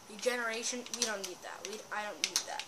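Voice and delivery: usual speaking voice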